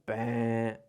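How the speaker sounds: imitated quiz buzzer, one held bleat; wrong-answer buzzer. Shown as a demonstration. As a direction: buzz out a long "wrong" sound. (som imitado de campainha de erro)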